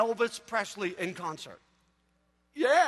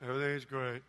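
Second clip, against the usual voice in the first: very low, soft and unexcited; mock-gloomy, joyless reassurance; deadpan glum mom; 10 semitones lower, 8 dB quieter than usual. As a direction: imitate an underwhelmed mom. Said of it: "Remember my mom?".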